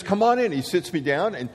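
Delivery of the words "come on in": jovial general. Retold or imitated. General invites him in.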